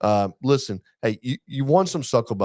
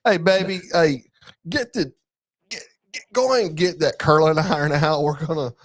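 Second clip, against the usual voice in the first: higher-pitched, hammed-up rural accent; put-on rural accent; 6 semitones higher than usual. Husband sends his wife for the curling iron, rural accent. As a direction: call out to baby in a thick country accent. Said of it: "So [name]'s gotta put an accent on it".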